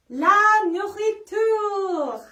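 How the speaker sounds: "La nourriture" said slowly, high and loud in careful French; French vocabulary demo for kids; French word spoken. Shown as a demonstration. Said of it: "Comment dit-on?".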